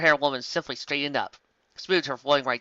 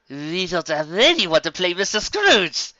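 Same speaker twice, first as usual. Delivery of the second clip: blurted out; 5 semitones higher, 6 dB louder, slower than usual. A narrator's reading. Ensign blurts out the problem.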